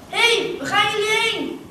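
put-on high, loud shout after departing kids; calling out. A narrator's reading. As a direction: call after them in alarm at full pitch. Hagrid calls after the children.

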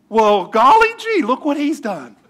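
high, drawn-out wide-eyed wonder; hammed-up gee-whiz astonishment; mock amazement. Scientists with magnifying glass marvelling at God's design, mock amazed.

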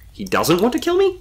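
high, puzzled question; confused. A narrator's reading. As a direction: let the end climb in bewilderment. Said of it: "Connor asked, confused".

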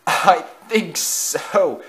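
slow, high, choked reply. A narrator's reading. Crashed engine answers the other, choked voice. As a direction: force it out through choking. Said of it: "choked ninety-four six two".

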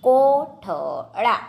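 કોઠળા very slowly, in separate syllables; slow word demonstration. Shown as a demonstration. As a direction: break up કોઠળા for spelling. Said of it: "જોડણી પર ધ્યાન આપજો".

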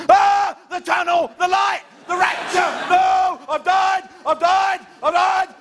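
high panicked shriek; panicked scream. Acted. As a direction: scream in terror at the light. Hypochondriac driver panicking.

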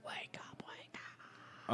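very soft, whispered wake-up call; playful hushed whisper; teasing whisper. Pretend. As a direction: whisper as if rousing a sleeper.

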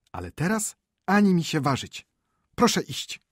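high-pitched, indignant ordering; haughty scandalised scolding; outraged wife. Acted. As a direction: lay down the law to your husband.

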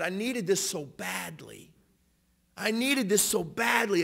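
high, drawn-out plea of gratitude, acted; imitated tearing up. Man given a sweater, imitated as tearfully thankful. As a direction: slowly act out tearful, desperate relief. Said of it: "as he began to tear up".

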